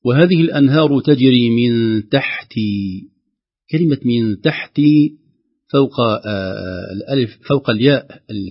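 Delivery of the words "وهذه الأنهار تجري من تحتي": measured chanted recitation, vowels held long; slow Quran recitation. Shown as a demonstration. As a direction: recite it slowly like a teacher showing tajweed. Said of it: "تأثرا بالراء المفخمة". (تلاوة مرتلة بطيئة)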